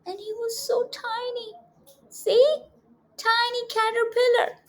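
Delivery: high little voice for something tiny; high, sweet voice for a tiny caterpillar. Acted. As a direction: pitch up to sound small and cute.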